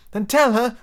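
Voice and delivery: put-on high, urgent girl's plea; begging. A narrator's reading. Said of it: "Wendy begged".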